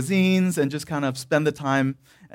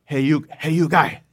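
put-on dad's Korean accent. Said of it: "he goes like".

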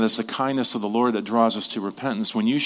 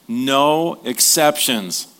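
slow emphatic reading; 2 semitones higher, 3 dB louder, much slower than usual. Acted. Preacher reads Peter's words.

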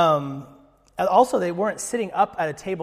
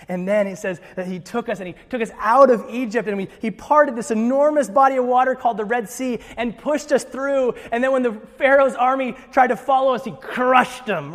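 put-on higher, animated storyteller father without accent; 6 semitones higher, 6 dB louder than usual. Father retelling Exodus to his family at the Passover table, higher and animated. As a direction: tell it like the head of the house at Passover, caught up in it.

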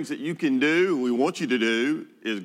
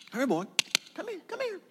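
high coaxing dog call, last call much higher. Shown as a demonstration. Pastor showing how you call a dog, high coaxing dog call. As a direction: coax the puppy over like calling a dog. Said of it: "you know how you call a dog".

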